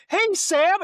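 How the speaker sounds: high, loud friendly hail; mock-chipper shouted greeting; cheery call-out. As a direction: call out to a pal going by. Beaver greets a passing fish.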